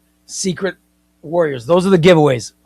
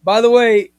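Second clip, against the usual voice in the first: ordinary talk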